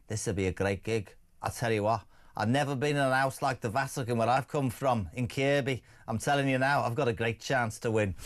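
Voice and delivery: lightly voiced cardinal, low-pitched; imagined cardinal's thoughts. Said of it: "He's thinking".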